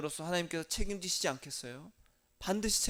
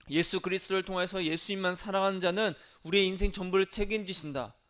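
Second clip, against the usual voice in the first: usual speaking voice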